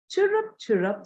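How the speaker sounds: high, lightly voiced sparrow chirps; chirping sparrows. A narrator's reading. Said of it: "says the sparrows".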